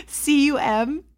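C U M spelled letter by letter; spelling it out. Shown as a demonstration. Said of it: "I'm gonna spell it for you".

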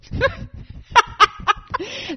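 high, helpless giggling fit; helpless laughter. Real, not acted. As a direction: crack up laughing. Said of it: "I can't even talk about it without laughing".

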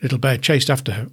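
usual speaking voice

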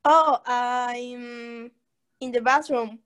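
usual speaking voice

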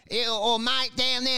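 slow, exaggerated regional accent; mock a comic's regional accent; broad put-on regional twang, drawn out. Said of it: "they'll do regional accents like".

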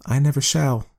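lightly voiced woman refusing; a hint of a firm wife; woman's voice. A narrator's reading. Woman character voice.

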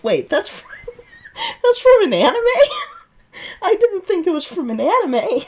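high, laughing surprise; laughing disbelief. Retold or imitated. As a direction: laugh through the question. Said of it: "and then I'm like".

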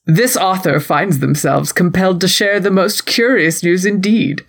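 formal society gossip columnist narration; mannered, ceremonious announcement of juicy news; Lady Whistledown. Acted. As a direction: read it like a period scandal sheet. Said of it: "Lady Whistledown's match report".

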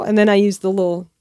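normal talking voice

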